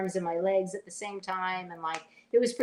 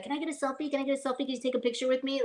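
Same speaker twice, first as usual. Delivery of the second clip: pestering selfie hunter; 4 semitones higher, much faster than usual. Retold or imitated. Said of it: "those people that's on set that's always like".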